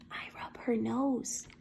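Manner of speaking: soft, slow, shy whisper. A narrator's reading. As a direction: say it like a timid child. Shy new friend answers.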